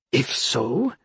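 ordinary delivery, nothing marked

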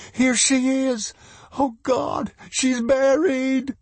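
very high, drawn-out cries of alarm; panicked mother. A narrator's reading. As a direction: cry out high in horror. Mother finds her child buried.

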